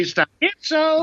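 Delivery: high-pitched Yiddish radio character; Yiddish accent. Retold or imitated. Recalling Yiddish accents on the radio, high Yiddish accent. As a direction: imitate an old radio comic's Yiddish accent. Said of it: "a lot of Yiddish accents".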